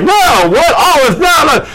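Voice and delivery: high, indignant no no; indignant olive tree. Acted. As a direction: refuse the crown outright. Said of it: "And they're like".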